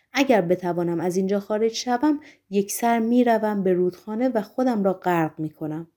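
usual speaking voice

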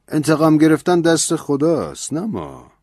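priest's stern reply. A narrator's reading. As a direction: lift it into the priest's stern rebuke. Priest rebukes Ben's revenge. (کشیش با لحنی جدی و بلندتر)

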